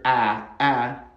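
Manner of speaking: "a" as in cat, held; English short a. Shown as a demonstration. Teacher plays the vowel learners mishear, held English "a". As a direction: sound out "a" halfway between ah and eh. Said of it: "when you hear".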